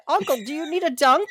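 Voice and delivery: a half-laughing plea to the uncle; cheerful nephew pleading while laughing; pleading through laughter. Acted. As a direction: try to plead but crack up. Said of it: "the nephew pleaded".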